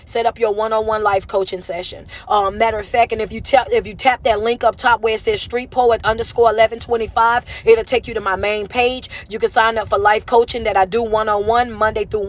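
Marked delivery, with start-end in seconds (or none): none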